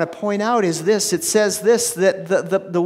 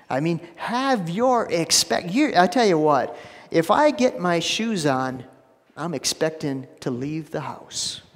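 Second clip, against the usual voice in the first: usual speaking voice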